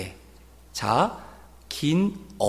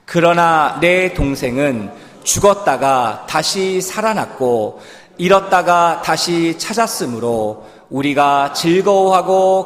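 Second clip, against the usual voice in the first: slow, louder solemn Bible reading; scripture reading; 6 dB louder, slower than usual. Acted. Preacher reading the verse aloud to the congregation, slow and solemn. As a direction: read each word weightily.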